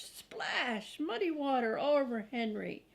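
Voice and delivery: ordinary delivery, nothing marked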